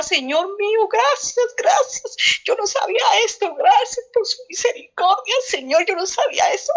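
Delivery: high, crying-style prayer of thanks; retold tearful thanks. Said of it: "yo lloraba con mis nervios".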